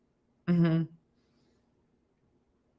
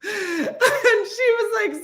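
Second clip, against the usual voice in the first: words squeezed out through laughter; can barely get it out for laughing; high, slow, breaking into laughter; much slower than usual. Real, not acted. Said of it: "It's so specific".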